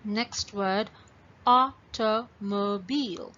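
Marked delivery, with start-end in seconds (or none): 1.5-3.3 "automobile" slowly, in four separate beats; broken into syllables. Shown as a demonstration. Teacher introduces the next word, "automobile" split into syllables. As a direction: gap after each syllable of "automobile". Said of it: "when you break this word".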